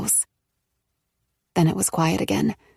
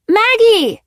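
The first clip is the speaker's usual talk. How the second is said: higher, louder, falling urgent shout; calling out; 8 dB louder than usual. A narrator's reading. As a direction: pound on the door and yell her name. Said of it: "she called".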